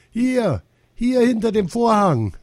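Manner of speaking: put-on high-pitched child's call; girl calling out. A narrator's reading. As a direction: pipe up from a hiding place. Ginny calls from behind the curtain.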